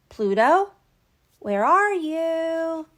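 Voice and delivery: calling the missing dog, slow and loud. Acted. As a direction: call loudly across the room. Mickey calling for his dog.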